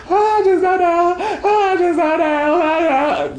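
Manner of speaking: very high wailing fake sob; mock sad crying. Shown as a demonstration. Showing listeners what bad crying is, high mock wail. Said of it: "That's not good crying. That's sad crying".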